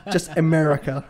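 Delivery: loud hammed-up American accent; laughing, exaggerated American twang; mock American accent. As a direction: belt it out like a caricature American.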